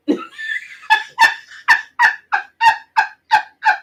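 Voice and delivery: a long squealing burst of laughter; high helpless laugh. Real, not acted. Scene: vlogger cracks up at her own story, squealing laugh.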